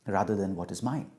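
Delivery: normal talking voice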